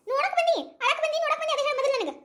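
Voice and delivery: high, insistent rebuke; cross scolding. Acted. Relative asks whether she came to visit or cry, scolding.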